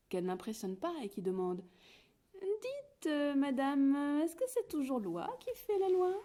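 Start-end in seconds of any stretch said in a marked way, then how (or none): 2.3-6.1 high cheeky chick question; little chick voice. A narrator's reading. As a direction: ask innocently, pitched high.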